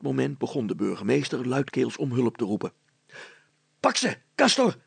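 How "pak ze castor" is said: high, loud roar at the dog. A narrator's reading. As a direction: yell to set the dog loose. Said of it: "brulde Meiling".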